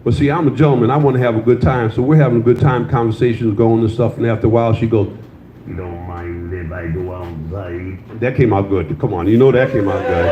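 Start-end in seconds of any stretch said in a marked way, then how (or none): 5.7-8.0 low, slow, soft rasp through a trach. Retold or imitated. Comedian imitates the date asking to step outside, slow trach voice. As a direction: drag it out like speaking through a trach.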